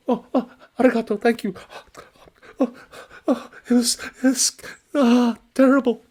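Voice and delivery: high, loud stammering terror; panicked stammer. Acted. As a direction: stumble over the words in fright. Shaken merchant thanks him.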